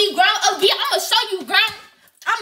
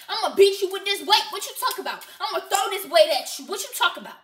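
ordinary delivery

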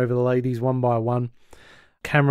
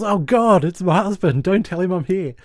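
high-pitched retold yell, mildly raised; mock panicked yell; 6 semitones higher than usual. Host retelling neighbour's shock at the doorbell, mock yell. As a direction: act out her alarmed cry, half in earnest. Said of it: "and she yells".

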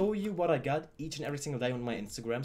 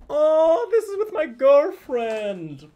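excited squeal; 12 semitones higher, 11 dB louder, much slower than usual. Real, not acted. Finds photo with girlfriend.

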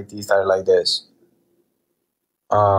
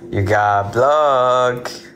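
taunting; 2 semitones higher, much slower than usual. Real, not acted. Taunting a blocked viewer.

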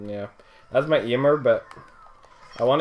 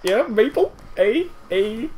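much higher mock Canadian accent; 10 semitones higher, slower than usual. Shown as a demonstration. Showing a friend how Canadians sound, high mock Canadian accent. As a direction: squeak out a silly Canadian accent. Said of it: "what does a Canadian accent sound like?".

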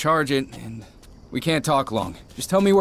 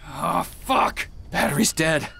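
frustrated curse; 4 semitones higher, slower than usual. Acted. Radio dies mid-call.

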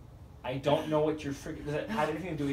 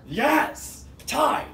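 excited shout; 12 semitones higher, 9 dB louder than usual. Real, not acted. Wins the round, cheering.

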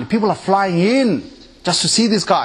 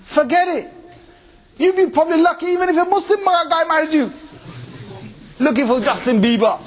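put-on scolding girls; 10 semitones higher than usual. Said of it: "It's like".

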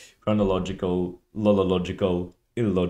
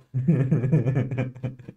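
loud, higher-pitched burst of laughter; real laugh; 6 semitones higher, 5 dB louder than usual. Real, not acted. Viewer cracking up at the video's thumbnail, laughing.

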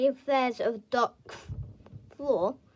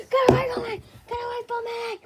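scared; 7 semitones higher, 4 dB louder, faster than usual. Acted. Fleeing the scary character.

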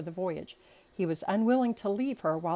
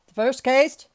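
loud, raised courtroom announcement; calling out; 5 semitones higher, 11 dB louder than usual. A narrator's reading. Judge opening the court session, calling out loudly.

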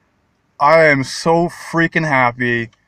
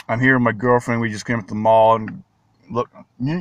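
ordinary talk

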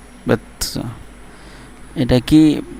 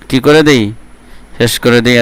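ordinary talk, nothing marked

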